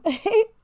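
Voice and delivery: squealing laugh; a quick high laugh; high burst of laughter. Real, not acted. Laughing at the word unalive.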